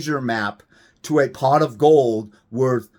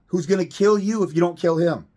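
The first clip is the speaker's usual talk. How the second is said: usual speaking voice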